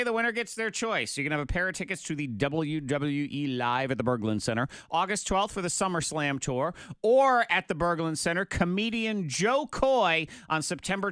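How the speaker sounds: booming game-show host; radio announcer. Acted. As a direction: hype it up like a host. Host announces on-air contest.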